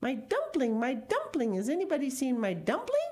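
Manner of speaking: high, urgent storybook call; singsong high cry, rising to a question. A narrator's reading.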